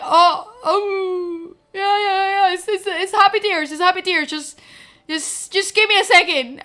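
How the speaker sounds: choking up while insisting it's fine; retold happy sobbing. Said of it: "literally sobbing".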